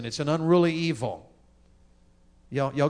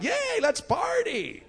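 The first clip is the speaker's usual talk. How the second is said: put-on high, slow, gushing teenager; excited teen; much slower than usual. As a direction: squeal it like a giddy teen. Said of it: "our teenager's like".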